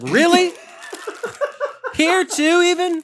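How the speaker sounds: high, loud exasperated squeal; mock disbelief. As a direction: shriek it in outraged disbelief. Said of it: "I was like".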